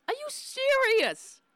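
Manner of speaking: high, slow disbelieving cry; shocked, voice shooting way up; incredulous shock. Real, not acted. Host reacting to senator's praise, shocked disbelief. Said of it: "Oh my God".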